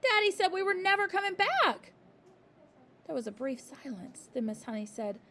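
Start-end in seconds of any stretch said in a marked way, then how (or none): 0.0-1.9 high loud despair; a girl near tears; distraught child. A narrator's reading. Matilda distraught.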